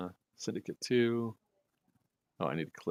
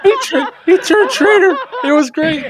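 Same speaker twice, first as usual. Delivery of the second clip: excited; 13 dB louder than usual. Real, not acted. Excited commentary on clip.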